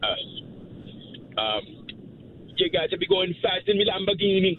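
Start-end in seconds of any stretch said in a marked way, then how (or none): 2.6-4.6 put-on higher Jamaican accent with a laugh. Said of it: "No, a Jamaican accent".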